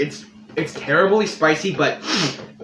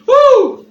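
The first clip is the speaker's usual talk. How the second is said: pained whoop; 12 dB louder than usual. Real, not acted. Burning mouth reaction.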